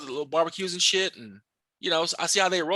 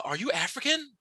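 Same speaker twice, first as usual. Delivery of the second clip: higher-pitched imitation of a clueless question; naive questioner; 6 semitones higher, 4 dB quieter than usual. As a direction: lightly mimic someone asking something silly. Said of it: "ask me stupid questions like".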